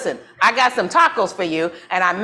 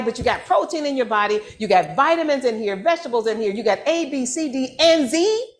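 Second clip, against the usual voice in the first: getting carried away with excitement; excited; 4 semitones higher than usual. Real, not acted. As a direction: preach the health benefits with rising fervor. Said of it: "I got a little excited".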